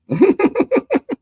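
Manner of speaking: cracking up at the clip; loud high laugh. Real, not acted. Host cracks up watching the clip, loud high laughter. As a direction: burst of high-pitched laughter.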